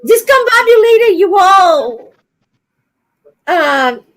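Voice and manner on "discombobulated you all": higher, louder Southern imitation; mock Southern. Brazilian woman imitating Southerners, mock Southern accent. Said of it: "I learned this word here in the South".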